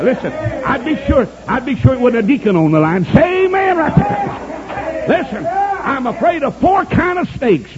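high, stretched pulpit cry; fervent preaching. Real, not acted. Preacher rousing the church over snakes, fervent preaching. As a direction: call for amens, long and high.

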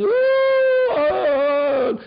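high crying howl; imitating a high crying howl of grief; howling wail. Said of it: "throwing dust in the air and howling".

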